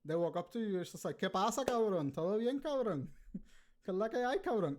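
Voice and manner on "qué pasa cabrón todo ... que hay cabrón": higher, lightly voiced Puerto Rican friend greeting in Spanish; a hint of a buddy saying hi in the street. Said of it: "everybody in Puerto Rico".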